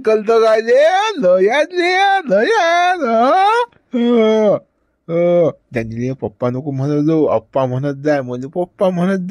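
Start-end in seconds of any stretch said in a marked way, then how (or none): 0.1-5.5 put-on much higher sing-song baby talk. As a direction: coo up high like to a baby.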